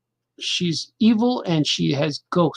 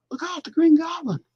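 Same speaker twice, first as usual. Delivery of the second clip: much higher, dramatic warning cry; Spider-Man movie quote; 11 semitones higher than usual. Retold or imitated. Recalling the Spider-Man scene, a high dramatic warning. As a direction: shout the warning like the movie hero. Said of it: "Remember that for Spider-Man?".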